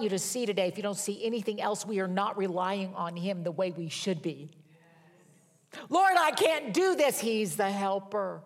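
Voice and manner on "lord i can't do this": high, loud pleading outburst; preacher voicing a worn-out believer crying out to the Lord, loud and high; desperate cry to God. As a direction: throw it up to heaven like you're at the end of your rope. Acted desperate prayer cry.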